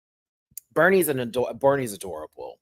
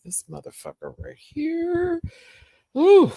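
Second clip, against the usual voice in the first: slow, much higher teasing voice; playful high voice; 5 dB louder, slower than usual. Real, not acted. Mock-introducing the baby.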